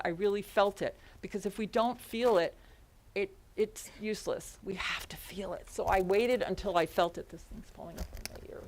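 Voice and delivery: normal talking voice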